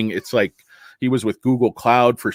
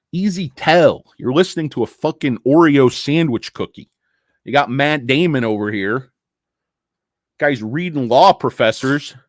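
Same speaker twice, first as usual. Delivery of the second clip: normal talking voice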